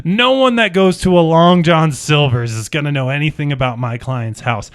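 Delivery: normal talking voice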